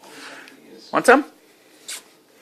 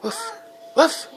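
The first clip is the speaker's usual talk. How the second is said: short playful woofs; two quick, separated pretend woofs; dog barking; 4 dB quieter than usual. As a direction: imitate a dog for a child. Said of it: "What's a dog say?".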